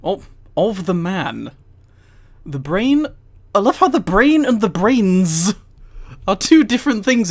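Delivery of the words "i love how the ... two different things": amused disbelief. Real, not acted. Amused incredulous comment.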